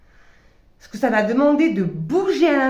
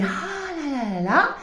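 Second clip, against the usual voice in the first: higher, slower, airy sigh of delight; light joyful side; 6 semitones higher, slower than usual. Retold or imitated. Her luminous side enjoying itself, in a higher, slow, dreamy voice. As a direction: let the bright dreamy self sigh happily. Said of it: "la lumineuse puisse faire des".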